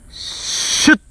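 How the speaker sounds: voiceless hiss like a spray can. Retold or imitated. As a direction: hiss out a quick spray burst. Imitating a bug spray. (殺虫剤を吹きかける音のまね)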